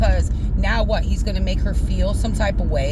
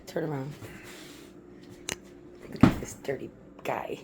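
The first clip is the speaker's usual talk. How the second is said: teasing whisper; 6 semitones lower, 13 dB quieter than usual. Real, not acted. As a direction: whisper "Look at this dirty guy." playfully. Teasing her partner on camera.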